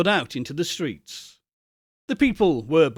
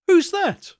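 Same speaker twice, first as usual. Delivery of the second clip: much higher, louder startled question; 5 dB louder than usual. Acted. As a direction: jump up in surprise at the door. Fred startled by visitor.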